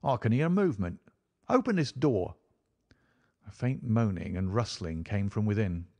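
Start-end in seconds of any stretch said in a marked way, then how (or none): 0.0-2.4 higher, urgent command; crying out. A narrator's reading. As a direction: call out urgently to those nearby. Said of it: "cried Lestrade".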